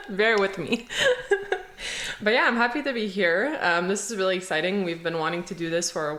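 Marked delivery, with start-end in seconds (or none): 0.9-2.2 longer high giggle, trailing off; embarrassed laugh. Real, not acted. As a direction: laugh off the nerves. Laughs at own nervousness.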